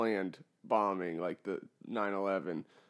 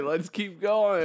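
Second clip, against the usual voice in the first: mock cheerful tourist; 8 semitones higher, 7 dB louder, slower than usual. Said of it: "and say".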